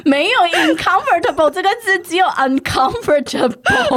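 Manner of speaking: high, loud laughing correction. Real, not acted. Teacher laughing while correcting the mixed-up word, high and loud. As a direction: correct him while cracking up.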